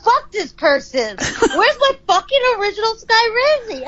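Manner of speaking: imitated fuming complaint, climbing high; retold angry outburst. Partner demanding the original singer, imitated as indignant. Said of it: "he got so fucking mad".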